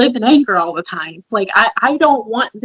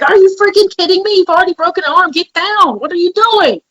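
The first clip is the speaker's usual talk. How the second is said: higher, louder mock yell at a child; mother half-yelling at her daughter jumping on the bed; imitating a yell; 9 semitones higher, 4 dB louder than usual. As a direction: reenact the exasperated yell you held back. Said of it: "I wanted to yell and I wanted to scream".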